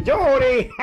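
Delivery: grito agudo y risa de bruja; llama y suelta la risa; bruja llamando y riendo. Acted. (la bruja llama a Yuri y ríe)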